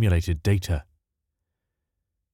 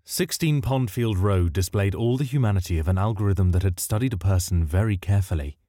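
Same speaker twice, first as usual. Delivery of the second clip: usual speaking voice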